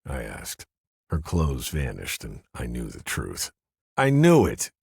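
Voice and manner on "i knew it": bitter. A narrator's reading. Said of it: "I said bitterly".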